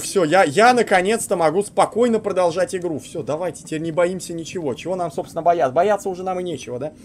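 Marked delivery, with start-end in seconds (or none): 0.0-3.0 louder, higher relieved exclamation; relieved excitement. Real, not acted. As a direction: gush with relief. Relief after saving the game.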